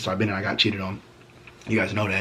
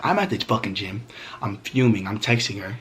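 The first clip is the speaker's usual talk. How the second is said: ordinary talk, nothing marked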